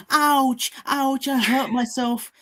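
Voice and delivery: mock pained whining. Podcaster mimics Odegaard complaining about hurting himself, mock pained. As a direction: whine it slowly, ridiculing an injured player. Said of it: "He's like".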